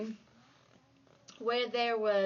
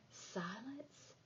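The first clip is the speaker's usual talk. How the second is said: much softer, nearly whispered question; whispered wonder; 20 dB quieter than usual. Acted. Narrator wonders where silence is, whispered. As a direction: breathe the question out gently.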